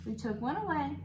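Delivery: normal talking voice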